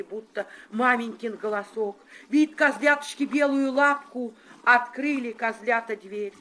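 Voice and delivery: plain reading voice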